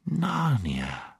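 soft, sweet, quiet witch voice. A narrator's reading. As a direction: coax it gently and quietly. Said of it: "speaking in a sweet, quiet voice".

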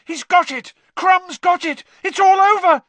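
very high, loud cries building; excited shout. A narrator's reading. Harry announcing the catch to his friends, shouting. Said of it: "shouted Harry".

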